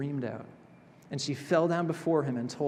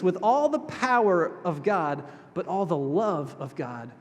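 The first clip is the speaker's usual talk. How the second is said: normal talking voice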